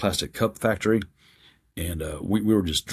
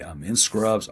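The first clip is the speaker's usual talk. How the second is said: ordinary talk, nothing marked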